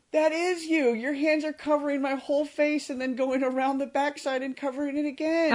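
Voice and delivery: put-on high, loud little kid gushing over a star; excited child fan. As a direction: squeal it like a starstruck kid.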